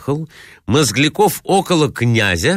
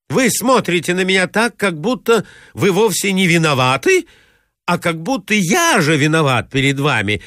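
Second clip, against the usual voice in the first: higher, rising indignation; exasperated protest; 7 semitones higher than usual. Acted. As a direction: fume at her calm tone. Exasperated suitor protests her tone. (высокий, нарастающий возмущённый тон)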